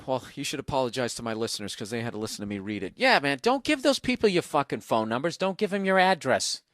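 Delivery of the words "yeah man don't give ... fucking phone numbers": normal talking voice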